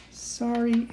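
plain reading voice

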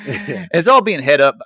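mock posh announcement; mock announcer. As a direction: announce it grandly.

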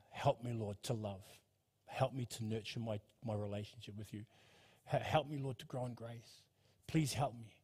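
soft, low, humble prayer; prayerful plea. Acted. Preacher voicing a plea to God for love, soft prayer. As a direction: pray it quietly and low, asking for help. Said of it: "by crying out to God".

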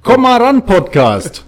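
high, loud jingle call; show title call. Pretend. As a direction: belt out the podcast name.

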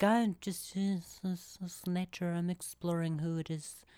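soft, airy murmur; lightly mocking free-spirit voice; carefree nature girl.

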